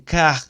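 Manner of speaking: car with the R rolled in the throat; car, loud, throat trembling on the R; French throat R. Shown as a demonstration. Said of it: "sua garganta está tremendo". (car com R vibrando na garganta)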